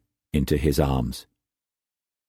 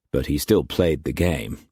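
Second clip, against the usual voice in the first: ordinary delivery, nothing marked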